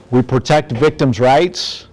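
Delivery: ordinary delivery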